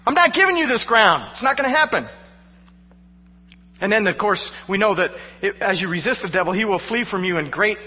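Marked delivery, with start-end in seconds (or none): 0.0-2.1 high, louder defiant declaration; defiant resolve. Pretend. Pastor voicing resolve against the devil, defiant and loud. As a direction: refuse to give an inch. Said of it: "images are very important".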